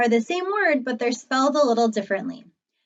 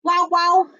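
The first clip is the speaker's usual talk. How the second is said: high, louder wow wow bark; playful Spanish-style dog bark; 5 semitones higher, 4 dB louder than usual. Shown as a demonstration. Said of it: "In Spanish, he says".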